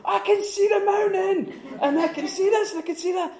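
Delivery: very high, laughing delight; awed wonder. Retold or imitated. Bilbo at the top of the tree, high and awed. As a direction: marvel like a child at the treetop. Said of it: "And he says".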